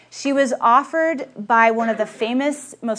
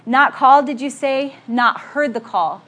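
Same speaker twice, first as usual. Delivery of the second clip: imitated booming voice, loud and raised at first; William Booth; 2 semitones higher, 5 dB louder than usual. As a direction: boom out the challenge, slowing at the end. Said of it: "in this booming voice".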